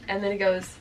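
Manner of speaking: ordinary talk, nothing marked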